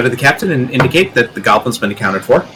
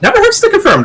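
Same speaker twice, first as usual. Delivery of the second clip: sing-song; 5 dB louder than usual. Real, not acted. Player's sudden high remark.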